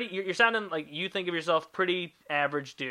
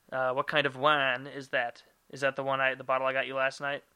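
ordinary talk, nothing marked

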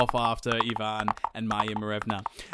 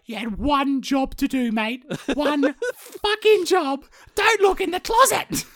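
shrill scolding wife; 12 semitones higher, 6 dB louder than usual. Retold or imitated. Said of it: "the first question is like".

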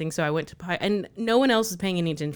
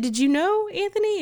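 higher teasing question to brother; high playful question; 4 dB louder than usual. Retold or imitated. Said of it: "I said".

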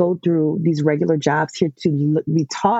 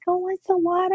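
put-on small child voice; 13 semitones higher than usual. Small boy grabbing bottle.

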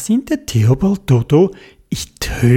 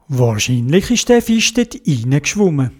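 ordinary delivery

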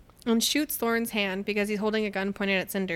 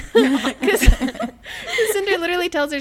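high, louder, helpless laughter in the words; breaking up laughing; 8 semitones higher, 8 dB louder than usual. Real, not acted. Co-host cracking up retelling a standoff, laughing. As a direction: talk through rising giggles.

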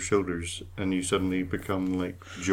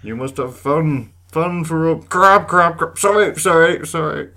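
high, loud, slow crab announcer; 8 semitones higher, 11 dB louder than usual. Pretend. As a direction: play a pompous crab controller. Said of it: "You've got a disembodied crab voice?".

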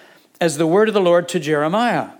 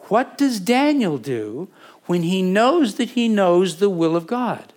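ordinary talk, nothing marked

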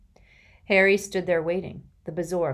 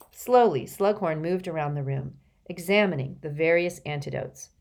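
usual speaking voice

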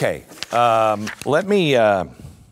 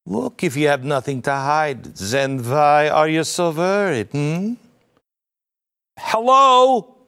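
put-on German accent; 3 semitones higher than usual. Mocking Schwab's words on privacy to the audience, in a German accent. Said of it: "not in a French accent, but maybe a German".

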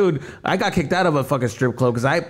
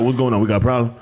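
low, firm guard voice; security guard; 4 semitones lower, faster than usual. Retold or imitated. Guard breaking up club dispute, low and firm. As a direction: drop the pitch and step in with authority.

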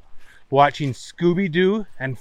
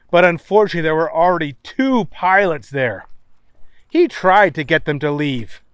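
ordinary delivery

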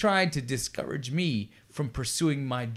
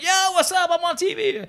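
very high, louder mock-excited shout-out; camera-hogging bystander; 3 dB louder than usual. Extra mugging for the film camera, hammed-up high-pitched excitement. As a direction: squeal it like an overexcited extra. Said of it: "people, like, going to the camera".

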